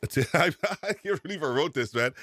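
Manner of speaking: embarrassed chuckle through the words; laughing while reading out his own answer. Real, not acted.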